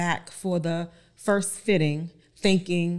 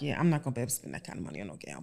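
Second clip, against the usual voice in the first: dismissive; 8 semitones lower, 9 dB quieter, much faster than usual. Retold or imitated. Her own past doubtful thought.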